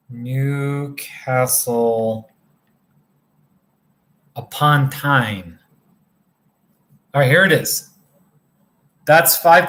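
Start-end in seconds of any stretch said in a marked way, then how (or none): 0.1-5.6 slow, drawn-out "Newcastle upon Tyne" with a pause before "upon"; careful second try. Real, not acted. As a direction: say "Newcastle ... upon Tyne" slowly and deliberately, word by word. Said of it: "let me try that again".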